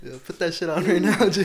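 loud laughing tease. Real, not acted. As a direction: shout it while cracking up. Teasing friend, laughing.

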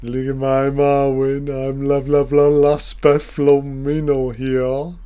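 lightly voiced imitation of Arwen's elvish talk; playful half-hearted elf speak; mock Elvish. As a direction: parody her strange speech with fake words. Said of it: "She's speaking weird".

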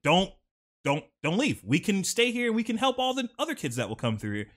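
high, urgent pleading in imitation; retold desperate begging. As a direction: plead like someone refusing to let go. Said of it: "he begins crying and begging".

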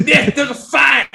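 loud, slow, laughing call for help; hammed-up cry for Nick; mock panic shout. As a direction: shout it like a frightened neighbour. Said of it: "retelling his rattled moment".

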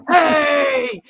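a gruff shouted "Hey!" imitating a male voice; male ghost voice. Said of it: "this male voice go".

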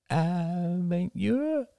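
put-on high, slow small-child voice; a drowsy little boy echoing the word; little boy just woken, high and slow; sleepy child murmur. A narrator's reading. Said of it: "murmured Ben".

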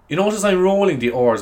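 ordinary talk

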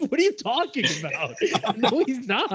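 outraged laughing squeak; high indignant protest. Retold or imitated. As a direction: pitch jumps way up, half laughing in disbelief. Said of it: "I was like".

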